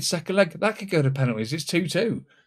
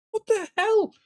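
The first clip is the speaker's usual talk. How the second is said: startled. Real, not acted. Shocked at game event.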